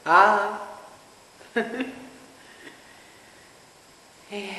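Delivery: ordinary talk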